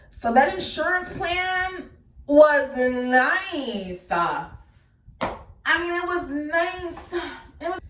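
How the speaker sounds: drawn way out, very slow, ironic; slow sarcastic drawl. Real, not acted. Mocking her mother's insurance payout, slow sarcastic drawl. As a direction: savor each word with dry irony.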